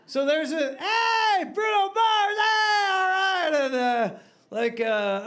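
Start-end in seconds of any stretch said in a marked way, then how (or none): 0.8-3.5 high shouted fan greeting; hammed-up fan yelling; mock fan shout. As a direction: call out to a star like a groupie.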